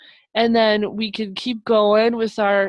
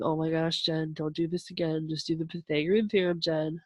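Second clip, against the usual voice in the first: imitating Casey; 3 semitones lower, 8 dB quieter than usual. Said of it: "Casey was probably sitting there going".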